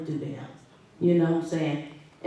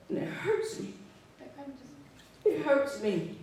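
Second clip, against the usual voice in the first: higher, softer, hurt voice close to tears; deeply hurt; 8 semitones higher, 6 dB quieter than usual. Real, not acted. Mother telling meeting her son lost his home, hurt. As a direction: let the hurt break through quietly. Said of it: "I'm hurt".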